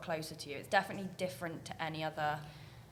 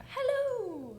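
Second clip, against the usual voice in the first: high, loud, hammed-up hello with a Scottish twang; imitating the boss's greeting; 4 dB louder than usual. Coworker mocks the boss walking into the office, loud high cheery hello. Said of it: "We're like".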